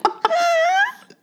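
high-pitched squeal of real laughter; laughing hard. Real, not acted. Host delighted by the strange noise in the clip, helpless laughter. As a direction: squeeze the words out through laughter.

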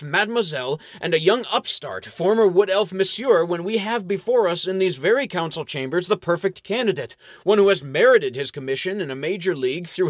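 a puffed-up orator sneering at rivals; bombastic. Acted. Toadley pompously scorning the candidates before the council. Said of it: "With bombast on his lips".

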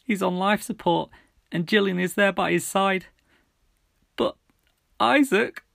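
fighting tears while telling; breaking bad news. A narrator's reading. Mum breaking tragic news.

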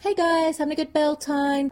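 high-pitched, breezy hello; cheery greeting. Acted. Perrin greeting his friends at the festival, cheery and high.

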